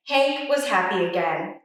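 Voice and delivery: ordinary delivery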